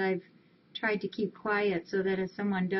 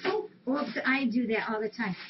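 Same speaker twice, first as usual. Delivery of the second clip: normal talking voice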